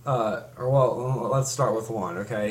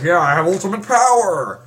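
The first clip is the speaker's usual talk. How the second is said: loud, high triumphant villain shout; villain boast; 7 semitones higher, 9 dB louder than usual. Retold or imitated. Mocking the toad villain gloating to a friend, loud. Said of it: "and he's like".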